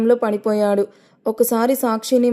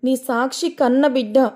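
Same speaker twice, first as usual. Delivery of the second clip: usual speaking voice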